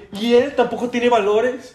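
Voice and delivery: mock teacher scolding. Teacher scolding another student.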